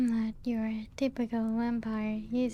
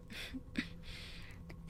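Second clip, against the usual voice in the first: very soft, brief chuckle; self-amused chuckle; 14 dB quieter than usual. Acted. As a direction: laugh faintly at yourself. Amused at own poor memory.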